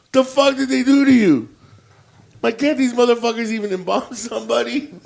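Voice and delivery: high, loud, horrified outburst; hammed-up dismayed protest; mock appalled outcry. Acting out shock at the body.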